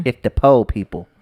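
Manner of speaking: 'po' said for poor, no r sound; jokey po slang. Pretend.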